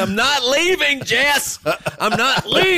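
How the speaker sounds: high, slow, laughing refusal; mock defiant husband. Husband refusing to leave the gym for Jess, high and slow.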